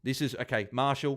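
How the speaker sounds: ordinary talk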